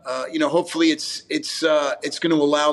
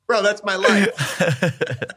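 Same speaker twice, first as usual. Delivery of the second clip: laughing; 4 semitones higher, much slower than usual. Real, not acted. Laughing at the meatballs joke.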